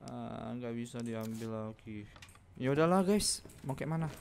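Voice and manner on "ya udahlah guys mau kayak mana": higher, louder resigned groan to viewers; loud exasperated sigh of defeat; exasperated giving up. Real, not acted. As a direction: throw up your hands, fed up. Gives up on locked safe.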